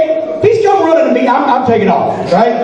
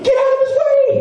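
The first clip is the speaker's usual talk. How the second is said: high yelled warning, pitched way up; screamed warning; 12 semitones higher than usual. Retold or imitated. Announcer jumping up screaming at the guy. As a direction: yell it up high in a panic. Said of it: "jumping up and screaming".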